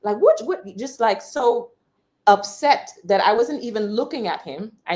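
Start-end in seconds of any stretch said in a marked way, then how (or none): 0.2-0.6 high, loud outraged bark; retold shout. Angry man ignored at the bank, shouting. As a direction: yelp it out in offended disbelief.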